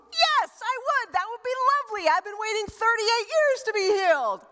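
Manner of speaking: high, bright, eager reply; eager grateful man. Pretend. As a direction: gush with gratitude, voice up high.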